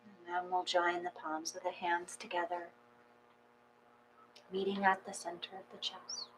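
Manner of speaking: soft, unhurried meditation guidance; guided meditation. Acted. Yoga teacher guiding the class into calm, soft meditation voice. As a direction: ease the class gently into stillness.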